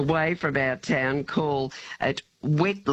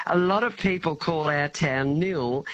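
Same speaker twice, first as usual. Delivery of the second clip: usual speaking voice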